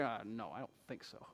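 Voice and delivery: soft, low, unimpressed refusal; grumbling dismissal. Retold or imitated. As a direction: mutter it like the other side. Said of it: "these people are like".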